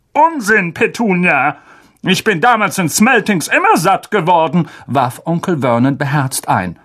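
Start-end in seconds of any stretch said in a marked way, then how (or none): 0.1-4.7 higher, tense blustering uncle; angry uncle. Acted. Uncle refusing Harry's request, tense and higher. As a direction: bluster at the boy.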